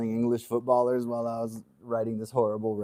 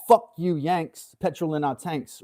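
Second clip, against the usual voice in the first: slow mock British accent; hammed-up British rap line; 5 semitones higher than usual. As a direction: drawl it out in a put-on British accent. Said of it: "does a better British accent".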